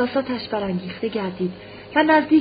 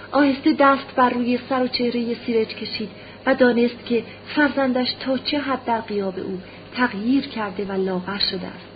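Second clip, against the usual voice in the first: ordinary delivery, nothing marked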